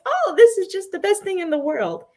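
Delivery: high-pitched mock wonder; imitated excitement. Imitating a bandmate finding joy anywhere, high and excited. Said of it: "he's so excited".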